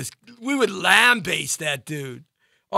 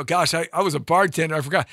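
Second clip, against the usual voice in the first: ordinary talk, nothing marked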